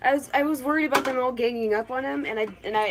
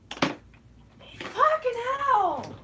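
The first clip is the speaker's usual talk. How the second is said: very slow, higher drawn-out groan; exasperated groan; 9 semitones higher, 3 dB louder, much slower than usual. Real, not acted. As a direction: stretch it out in despair. Cricket lost, exasperated.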